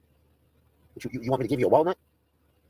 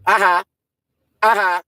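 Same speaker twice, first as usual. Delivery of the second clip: loud, high mock "aha"; short mocking exclamation, pitched up; taunting "aha"; 6 semitones higher, 7 dB louder, slower than usual. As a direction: burst out a raised, jeering "aha". Taunting that he can't run.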